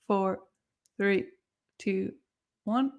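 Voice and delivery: ordinary talk, nothing marked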